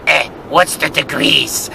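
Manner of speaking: slow, put-on Turkish-accented question; imitated Turkish accent. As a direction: open with a grunt, then drag out the Turkish accent. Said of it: "he goes like this".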